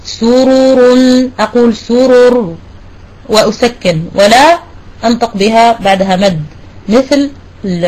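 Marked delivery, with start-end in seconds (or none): 0.0-2.6 slow "سرور" with no nunation at the end; stopping with sukun. Shown as a demonstration. Teacher models stopping on "سرور". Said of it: "في الأمثلة السابقة قلنا".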